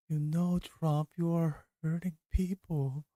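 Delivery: high, pleading mock sob voice; high whiny imitation of a tearful plea; mock tearful Kamala. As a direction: plead up high like you're about to cry. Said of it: "the tone of voice is like".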